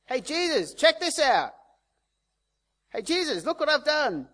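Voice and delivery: mock someone clamouring for Jesus to admire them; hammed-up look-at-me shout; showing off. Mock show-off calling to Jesus.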